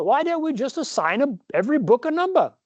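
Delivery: put-on American hamming up a British accent; playful British-accented suggestion; fake British accent. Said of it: "practice my fake British accent".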